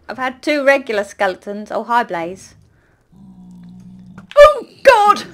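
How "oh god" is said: high, loud startled scream; a sudden shriek of fright; real shriek. Real, not acted. A blaze jumps her and she shrieks in real fright.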